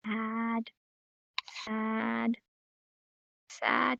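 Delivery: very soft, low, downcast; sad. Shown as a demonstration. Teacher demonstrating the sad sign, downcast. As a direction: let the word droop quietly. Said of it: "your mind starts going and you get".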